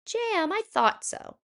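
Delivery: put-on a sweet old lady lifted high; Bubba Braina.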